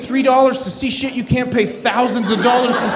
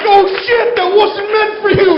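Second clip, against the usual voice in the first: much higher, louder frantic blurt; mock panic; 10 semitones higher, 5 dB louder than usual. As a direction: blurt it out, horrified at your mistake. Comic panics over misdirected text.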